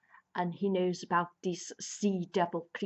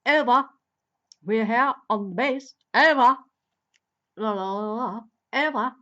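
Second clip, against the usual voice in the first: loud, clipped radio-operator "over"; mock radio call; 3 semitones higher, 10 dB louder than usual. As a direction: bark it like a walkie-talkie report. Doctor radioing over intercom.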